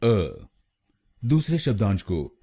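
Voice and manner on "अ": अ said as a short neutral "uh"; a clipped, weak "uh" for अ; syllable demo. Shown as a demonstration. A teacher modelling the first syllable, अ as "uh". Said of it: "ए हम बोलेंगे अ".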